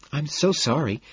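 high, sugary apology; apologetic hostess. A narrator's reading. Evelyn apologises while dragged away.

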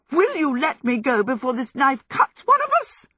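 high, loud, flustered protest; indignant squawk. A narrator's reading. Sophie protests at being spun with a knife, high squawk. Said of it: "Sophie squawked".

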